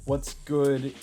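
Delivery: ordinary delivery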